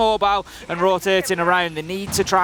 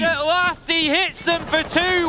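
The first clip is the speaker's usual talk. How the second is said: higher, hyped shout-casting of the big play; excited play call; 8 semitones higher than usual. Real, not acted. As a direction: sell the long-awaited moment. Caster hypes long-awaited play.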